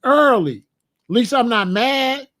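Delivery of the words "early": Early said high and loud, punched; loud demo of saying Early; emphatic. Shown as a demonstration. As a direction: hit Early hard, a short falling punch. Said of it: "I'll say it just like that".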